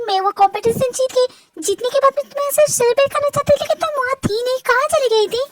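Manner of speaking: put-on high, insistent young voice; eager young winner. Winner asking Saniya where they disappeared to, high and insistent. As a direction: press the friend in a high voice.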